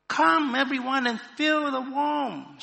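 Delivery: high, loud, slow invitation; calling invitation. A narrator's reading. Warmed woman inviting all the cave people, loud calling. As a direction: call everyone over, high and drawn out. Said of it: "she invited".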